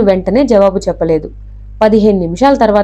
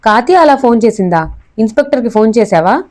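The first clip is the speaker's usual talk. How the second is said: plain reading voice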